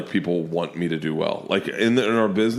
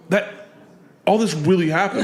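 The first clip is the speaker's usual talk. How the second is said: mock amazement, pitched up; blown-away child; 9 semitones higher than usual. Daughter to dad on finding his story true, retold amazement. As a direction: imitate a daughter's high-pitched disbelief. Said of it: "they were so blown away".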